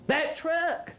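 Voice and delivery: high startled exclamation; surprised cry. A narrator's reading. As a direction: gasp out "That truck!". Delbert exclaims on seeing truck.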